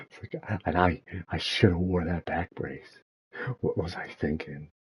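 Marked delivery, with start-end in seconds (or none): none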